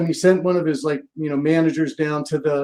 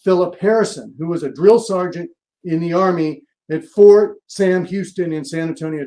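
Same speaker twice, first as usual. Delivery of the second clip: ordinary talk, nothing marked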